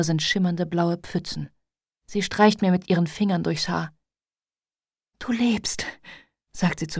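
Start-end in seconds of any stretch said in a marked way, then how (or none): 5.2-5.9 unvoiced, hushed whisper; mother whispering to her daughter. A narrator's reading. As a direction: breathe it out in relief. Mother whispers over hospital bed.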